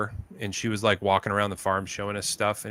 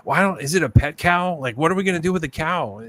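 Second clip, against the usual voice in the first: higher, louder, baffled retelling of his friend; exasperated buddy; 7 semitones higher, 5 dB louder than usual. Retold or imitated. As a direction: voice the husband stuck with a cow, at a loss. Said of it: "He goes".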